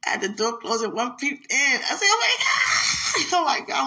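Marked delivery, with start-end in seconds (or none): 2.0-3.9 very high, loud shriek; shocked scream. Retold or imitated. Woman on the toilet screaming when a mouse peeps in. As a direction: scream in fright.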